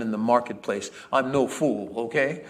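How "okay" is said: ordinary delivery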